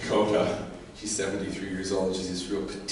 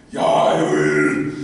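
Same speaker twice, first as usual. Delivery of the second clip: low, loud, drawn-out voice; hammed-up deep, heavy agreement; booming acted reply; 5 semitones lower, 11 dB louder, much slower than usual. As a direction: answer in a big slow low boom. Acted reply to the ominous command.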